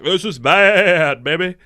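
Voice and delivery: hammed-up slow drawl of a bad-boy; lightly hammed-up cool guy, dragged slow; cool guy quote. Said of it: "one guy's like".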